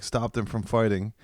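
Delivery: ordinary talk, nothing marked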